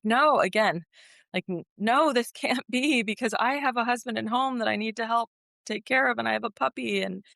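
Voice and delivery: high-pitched, pleading protest; retold disbelief, voice lifted high; desperate denial. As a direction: protest it, refusing to accept it. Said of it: "just wanting to will it away".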